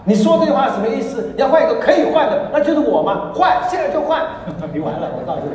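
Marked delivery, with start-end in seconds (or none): 0.0-4.2 loud, higher-pitched mock outrage; indignant wife. Wife challenging her husband over his remark, mock indignant. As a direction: act out an offended wife's heated retort. Wife's retort in retold quarrel.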